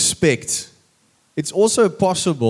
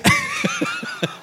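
a delighted squeal of a laugh, far higher; real high laugh; 4 dB quieter than usual. Real, not acted. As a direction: let out a high shriek of laughter. Pastor laughs at story.

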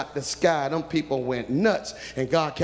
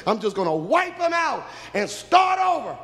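angry God; 10 semitones higher, 3 dB louder than usual. Acted. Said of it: "No, Moses".

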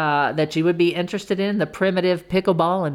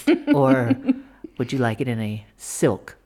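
sultry voice; 6 semitones lower than usual. Retold or imitated. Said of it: "she has that sultry voice".

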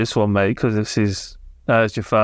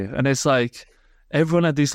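ordinary talk

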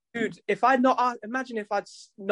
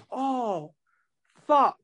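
retelling the moment it dawned on him; sudden realization; 6 semitones higher than usual. Retold or imitated. Realizing he misheard the customer.

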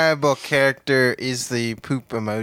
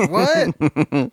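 usual speaking voice

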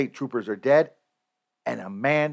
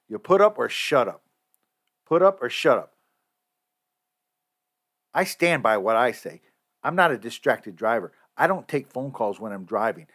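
usual speaking voice

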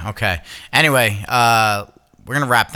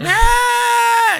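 short high loud wail; high cry of a hurt baby; 6 dB louder than usual. Retold or imitated. Baby falling off the bed, a short high wail. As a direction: mimic a toddler's startled cry.

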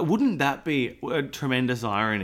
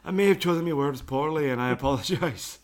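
put-on Scottish accent; 3 semitones higher than usual. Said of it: "him happily plucking away".

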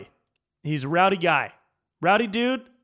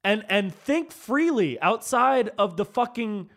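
slow, higher and louder, hammering each phrase; emphatic rant; 5 semitones higher, 3 dB louder, slower than usual. Real, not acted. Telling partisans to think freely, slow emphatic rant. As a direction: spell it out like a frustrated preacher.

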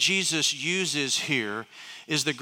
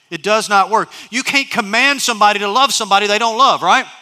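loud, higher, driving sermon voice; preaching at full force; 5 semitones higher, 7 dB louder than usual. Acted. Pastor pressing the congregation, loud and fervent. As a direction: preach it hard and fast to the room.

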